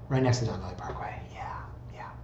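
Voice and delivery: confiding whisper, quick; hushed repeat. Pretend. Host admitting the house sits by the parkway, whispered. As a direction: whisper it like an awkward secret.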